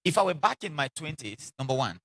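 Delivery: usual speaking voice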